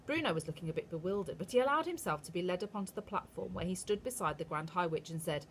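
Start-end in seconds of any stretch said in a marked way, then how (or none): none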